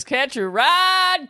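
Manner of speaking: high drawn-out slow call; silly catchphrase voice. Acted. Host shouting a goofy catchphrase to campers. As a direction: stretch it out in a goofy voice. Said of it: "this is not my real accent".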